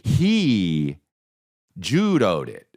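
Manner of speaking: ordinary talk, nothing marked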